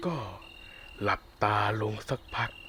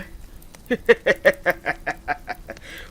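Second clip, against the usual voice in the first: airy, high, loud amused laugh; wolf laughing at his own threat; breathy laugh; 5 semitones higher, 5 dB louder than usual. Acted. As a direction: laugh at her fear. Wolf jokes about eating her.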